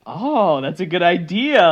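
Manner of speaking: a hammed-up lightbulb moment; sudden inspiration. Character gets sudden inspiration.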